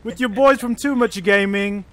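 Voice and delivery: loud, energetic video intro; Let's Play voice. Pretend. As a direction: open the gaming video like a hyped host, loudly. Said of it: "you do your Let's Play voice".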